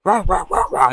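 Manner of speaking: loud, high imitated barks; spoken "rah" barking; dog barking. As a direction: bark rapidly like an angry dog. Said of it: "Every time a dog starts barking".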